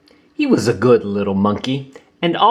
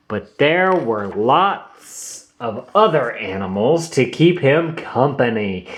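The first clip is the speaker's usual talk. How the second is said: ordinary delivery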